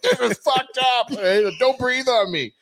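high, slow, hammed-up squeamish plea; mock panic. Imitating himself in the distanced sale meeting, mock alarm. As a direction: play it jumpy and alarmed. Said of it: "I'm like".

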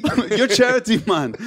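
hammed-up, muddled Jamaican lilt; bad Jamaican accent. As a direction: botch a Jamaican accent through laughter. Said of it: "accent was that? Your Jamaican".

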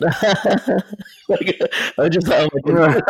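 high, real laughter breaking up the words; laughing. Real, not acted. Storyteller recalling the crush's big kiss, laughing. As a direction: try to speak through helpless giggles.

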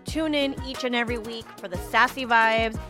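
bright intro like a radio host; podcast announcer. Acted. Recorded podcast intro over music.

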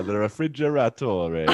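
"refrigeratore" rolled out with mock-Italian ending; playfully pompous "refrigeratore"; mock-fancy mispronunciation. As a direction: add a grand extra syllable to "refrigeratore". Joining in the mangled-word game.

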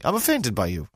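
high, indignant mock outrage; hammed-up affronted shopper; frowny outrage. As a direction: act offended, as if it's a prank. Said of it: "in this kind of frowny sort of".

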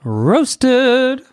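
high, loud gleeful cry; gleeful shout. Real, not acted. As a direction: crow it out in glee. Gleeful cry over burning ogre.

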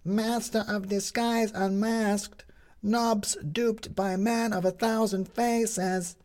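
high, slow bellowed headline cry; small girl on a paper pile bellowing headlines. A narrator's reading. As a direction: hawk the papers at the top of your voice. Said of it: "bellowing at the top of her voice".

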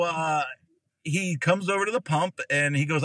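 normal talking voice

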